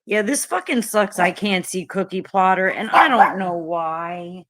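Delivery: ordinary talk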